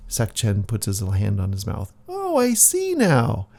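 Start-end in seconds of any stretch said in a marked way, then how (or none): 2.1-3.5 high realization. Acted. Sudden realization in squeaky voice.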